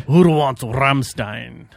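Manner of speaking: slow, loud, stretched-out call; drawn-out call. Retold or imitated. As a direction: drag it out like an announcer. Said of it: "That's what I say".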